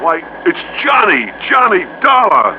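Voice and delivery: light up as you spot him; surprised recognition. Acted. Businessman spotting an old friend on the plane, delighted surprise.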